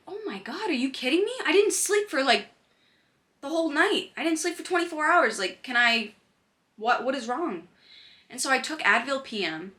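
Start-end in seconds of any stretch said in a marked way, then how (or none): 0.1-7.7 high, exasperated oh my God disbelief; reliving the panic of a sleepless night; disbelieving panic. Retold or imitated. Girl retelling her sleepless panic, high and disbelieving.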